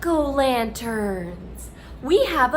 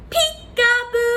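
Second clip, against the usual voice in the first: excited peekaboo; 11 semitones higher, 4 dB louder than usual. Shown as a demonstration. Peekaboo reveal.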